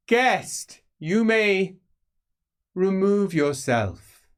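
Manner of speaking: high, loud, slow royal command; slow, lofty dismissal. Acted. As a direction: order the guest out with cold authority. Prince dismisses the guest.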